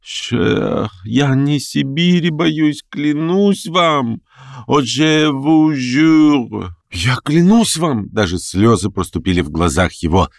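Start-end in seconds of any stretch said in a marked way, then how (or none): 0.0-8.1 a hint of a frightened old gentleman, slow and high; lightly voiced aging intellectual swearing he is not afraid; pleading old man. A narrator's reading. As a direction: beg him to believe you, drawn out.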